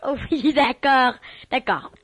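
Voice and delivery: normal talking voice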